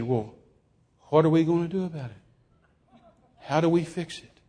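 soft, subdued asking; humbled question. Retold or imitated. Humbled preacher asking how to fix it, subdued. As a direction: ask helplessly, with little voice.

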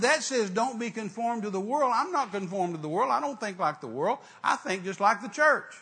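high, loud, insistent rebuttal; offended self-defence. Retold or imitated. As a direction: argue back indignantly, point by point. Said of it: "That just kind of offended me".

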